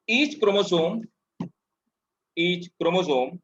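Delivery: slow, word-by-word dictation voice. Acted. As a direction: dictate each phrase slowly for notes. Teacher dictating notes slowly.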